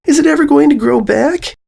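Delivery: high, small filly voice calling her sister; a little girl asking timidly; young filly voice. A narrator's reading. Filly calling her sister, high and small.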